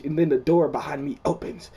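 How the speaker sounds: ordinary talk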